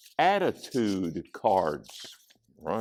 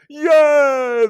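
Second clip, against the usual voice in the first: high, loud, jubilant shout; yelled cheer; 11 dB louder than usual. A narrator's reading. Class cheers for ice cream, yelling high. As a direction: cheer at full voice. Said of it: "they all yelled".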